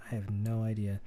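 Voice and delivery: usual speaking voice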